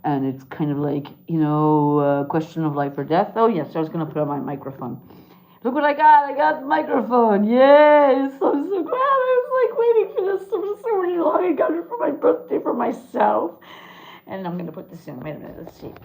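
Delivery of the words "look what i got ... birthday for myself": high, squealing delight over a new gadget; excited squeal. Real, not acted. Clown gushing to viewers about her new microphone, squealing. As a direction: gush with real glee, pitch way up.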